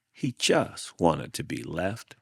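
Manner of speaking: ordinary delivery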